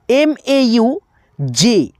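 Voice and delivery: very slow, letter by letter; drawn-out letter names; slow spelling. Shown as a demonstration. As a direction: spell it out slowly for the class. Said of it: "teacher spells letters out".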